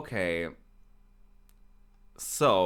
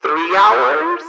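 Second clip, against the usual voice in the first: surprised; 10 semitones higher, 3 dB louder than usual. Real, not acted. Disbelief at time taken.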